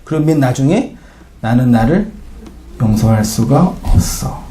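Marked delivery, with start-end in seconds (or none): 1.4-4.4 slow, low despairing confession; lightly acted dying despair; a hint of final despair; dying last words. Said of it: "그러고 죽어요".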